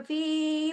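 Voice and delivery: फी with the ee held out; long madd. Shown as a demonstration. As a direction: stretch the vowel of फी. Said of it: "अब दोनों को बराबर". (फी को खींचकर)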